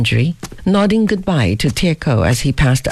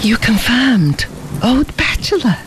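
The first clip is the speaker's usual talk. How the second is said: exclaiming; 7 semitones higher than usual. A narrator's reading. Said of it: "ejaculated Fabian".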